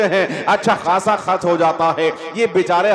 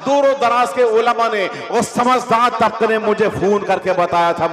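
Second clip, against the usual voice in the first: ordinary delivery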